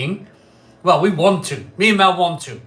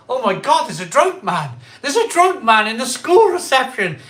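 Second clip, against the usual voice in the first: mock-alarmed receptionist, pitched higher; alarmed secretary; 7 semitones higher, 6 dB louder than usual. Secretary spotting a drunk man in school reception, mock alarm. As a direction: lightly act a scandalised cry, louder and higher. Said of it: "And, and she goes".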